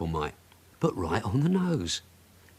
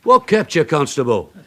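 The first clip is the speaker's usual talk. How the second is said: loud; 4 semitones higher, 7 dB louder than usual. Acted. Sergeant calls to returning constable.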